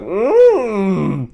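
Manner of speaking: loud, high moan of food pleasure; hammed-up yummy moan; mock pleasure moan. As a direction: imitate a guy moaning over strawberries. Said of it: "this guy is just moaning".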